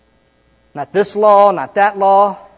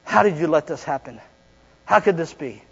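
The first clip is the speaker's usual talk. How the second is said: subdued questioning; 3 semitones lower, 7 dB quieter than usual. Acted. Voices hurt person questioning God.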